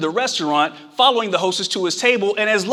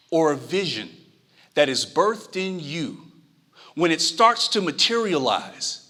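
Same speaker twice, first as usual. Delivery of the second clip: slow, softer sermon voice; soft preaching; 4 semitones lower, 4 dB quieter than usual. Acted. Preacher softly urges listeners to hold onto their vision. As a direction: lean in and make it land.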